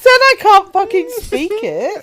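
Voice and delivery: loud, laughing, mock-indignant; laughing outburst. Real, not acted. Co-host defends herself while laughing loudly. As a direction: shout it while cracking up.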